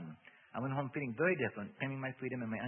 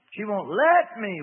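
slow, high, loud wail; slow loud demon wail; 7 semitones higher, 14 dB louder, much slower than usual. A narrator's reading. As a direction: draw it out as a desperate wail. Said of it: "wailed the demon".

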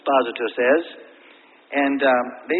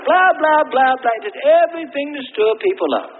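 loud mocking blah blah; 7 semitones higher, 6 dB louder than usual. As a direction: rattle it off with scorn. Mocking agitators' chatter.

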